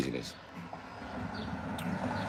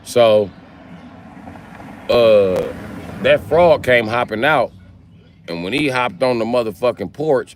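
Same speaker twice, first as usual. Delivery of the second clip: ordinary delivery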